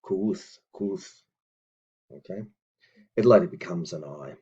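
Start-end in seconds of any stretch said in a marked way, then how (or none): 0.0-1.2 "Kuuth" with the vowel made through rounded lips; "kuuth", an English "ee" said with rounded lips. Shown as a demonstration. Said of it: "by rounding your lips".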